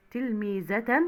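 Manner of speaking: تلميذة with a -tan ending; تنوين بالفتح. Shown as a demonstration. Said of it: "وتنوين بالفتح". (نطق تلميذة بفتحتين في آخرها)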